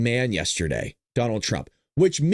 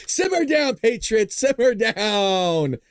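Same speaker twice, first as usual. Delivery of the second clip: raised voice, last words drawn out; mock calming; 6 semitones higher, much slower than usual. Host telling excited viewers to simmer down, mock calming call. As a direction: playfully settle a rowdy crowd.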